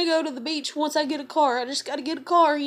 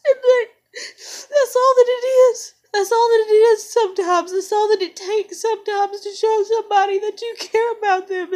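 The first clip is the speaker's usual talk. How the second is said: much higher and louder, wailing through tears; anguished sobbing lament; 4 semitones higher, 6 dB louder than usual. Real, not acted. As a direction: cry it out in despair. Breaks down crying, asks why.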